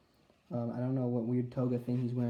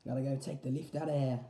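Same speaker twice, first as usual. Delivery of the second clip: put-on British accent; 2 semitones higher than usual. Spider UK voice continues.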